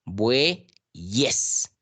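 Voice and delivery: "bueyes" split bue... yes, with a consonant y; clear syllable split. Shown as a demonstration. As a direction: break "bueyes" slowly before a firm y. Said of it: "Aquí está clarísima la pronunciación".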